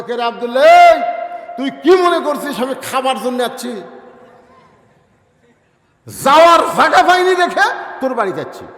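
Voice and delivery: father shouting at Abdullah through the door; angry shout. Acted. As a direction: bellow it at your son in wounded pride. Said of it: "চিল্লায় বলতেছে".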